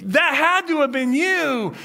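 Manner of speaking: loud, high, insistent accusation; accusing outburst. Acted. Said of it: "he finally speaks up and he goes".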